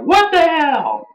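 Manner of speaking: high, slowed-down mock outrage; outraged what the hell. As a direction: stretch it out in disbelief. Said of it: "saying, like".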